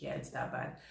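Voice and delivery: very soft, low retold confession; hushed admission. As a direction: drop right down to admit it quietly. Friend finally admits it is bad.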